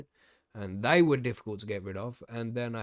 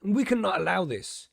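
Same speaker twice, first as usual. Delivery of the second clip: raised, emphatic public warning; rally everyone against the invaders; mock rousing speech; 2 semitones higher, 4 dB louder than usual. Host warning growers about freeloading bugs, mock rousing speech.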